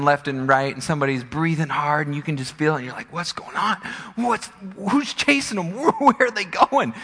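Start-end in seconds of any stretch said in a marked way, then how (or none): 3.1-6.9 high-pitched, urgent questioning; acted alarmed curiosity; anxious onlooker. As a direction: wonder aloud, stumbling over the questions, voice jumping high. Viewer reacting to chase scene.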